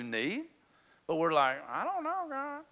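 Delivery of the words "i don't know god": high, soft, helpless little voice; mock-helpless plea; helpless whine. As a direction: whine up at God like a lost kid. Whiny prayer voiced for us.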